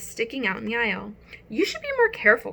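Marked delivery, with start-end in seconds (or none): 1.5-2.5 high, quick scold; a girl scolding a careless boy. Acted. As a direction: tell him off sharply.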